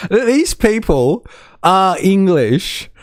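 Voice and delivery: high, slow mocking voice; a streamer mocks Tommy's English, high and slow; mock incredulous. Mock disbelief, high and slow.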